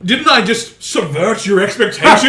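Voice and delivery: loud, high gloating taunt; smug triumphant crowing. Acted. Gloating at Ryan.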